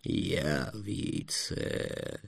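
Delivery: low, very slow, hushed mutter; soft, drawn-out dragon mumble. A narrator's reading. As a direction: murmur it like a self-soothing mantra. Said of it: "бормотал дракон".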